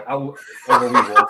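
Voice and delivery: insisting through laughter; laughing. Real, not acted. Podcaster picking Cosby's dance number over the movie, laughing. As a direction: laugh while standing by your answer. Absurd choice amid group laughter.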